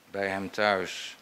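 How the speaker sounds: ordinary talk, nothing marked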